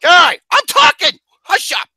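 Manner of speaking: high, loud demand for silence; annoyed shout. Real, not acted. Host to co-host who interrupts him, annoyed shout. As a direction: shout down someone butting in.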